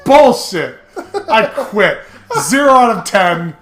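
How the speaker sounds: loud, high outburst with laughter; comic rage-quit. Real, not acted. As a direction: yell a fed-up verdict, half laughing. Ranting at the game.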